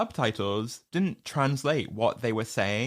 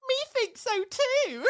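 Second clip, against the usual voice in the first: much higher, slow squeaky shout; squeaky little girl. Retold or imitated. Little ginger girl shouting at Harriet, high squeaky shout. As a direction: shout it like a tiny timid girl finally brave.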